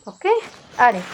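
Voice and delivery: ordinary delivery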